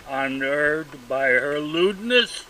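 ordinary delivery, nothing marked

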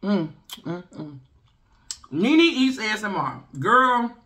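muffled between bites; chewing while talking. Real, not acted. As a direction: mouth partly full of food. Seen 1: Bit corn just before; lips still working, corn held at mouth.

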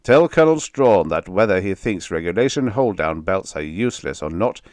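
loud commanding general; gruff order. Acted. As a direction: lay down the order, no argument. General ordering his aide.

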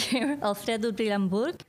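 ordinary delivery

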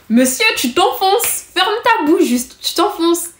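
high-pitched, drawn-out mock telling-off; exasperated retort. Girl telling the boy to stop digging, high and exasperated. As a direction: cut him off in a high, exasperated voice.

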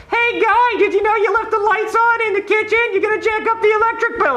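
very high-pitched mock wife; nagging wife yelling. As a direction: imitate a wife yelling complaints in a piercing high voice. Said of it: "she's gotta yell at me all day like".

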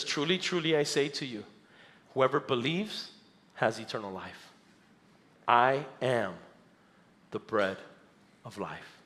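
low, measured pronouncement; blunt Jesus. Acted. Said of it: "Jesus says it bluntly".

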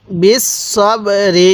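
slow, drawn-out বিস সব রি; model recitation. Shown as a demonstration. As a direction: hold each syllable of বিস সব রি, separately. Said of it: "কীভাবে পড়ব?".

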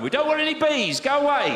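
high, loud shout; phone shout. Retold or imitated. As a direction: bark it down the phone.

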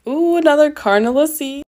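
high, loud delighted ooh; excited. Real, not acted. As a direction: exclaim it high and loud, about to laugh. Excited reaction to another carnelian.

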